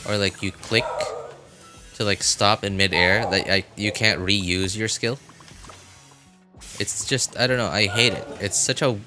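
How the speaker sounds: usual speaking voice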